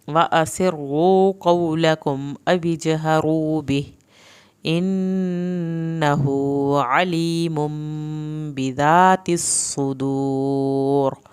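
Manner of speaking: slow chanted tajweed recitation; Quran recitation. Shown as a demonstration. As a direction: intone the verses slowly. Teacher recites verses.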